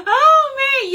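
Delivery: high, loud voice still full of laughing; tail of laughter. Real, not acted. Vlogger recovering after cracking up at chat, laughing. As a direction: recover from a real laughing fit.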